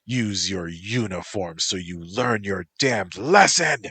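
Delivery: loud barked order; angry yell. Acted. Motoko yelling at Antropos to clean the floor, loud angry order. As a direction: bark the order down at him. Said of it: "she yelled".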